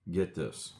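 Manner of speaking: ordinary delivery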